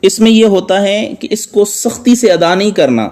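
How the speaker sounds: ordinary talk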